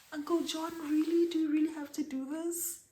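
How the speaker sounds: high incredulous retold cry to Uncle John; shocked protest. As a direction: squeal it out, can't believe him. Her shocked reply retold.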